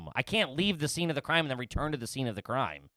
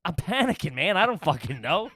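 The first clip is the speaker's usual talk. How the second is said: ordinary talk, nothing marked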